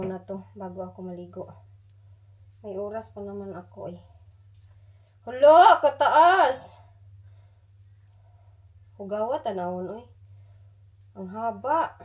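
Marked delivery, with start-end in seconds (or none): 5.3-6.8 loud startled exclamation; surprised. Real, not acted. Seeing her long nails, loud surprise. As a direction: react in shock at the length.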